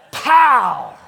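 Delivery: sudden loud pow like a punch; comic shouted pow; shouted mock pow. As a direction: bark out the punch sound. Acted punch line.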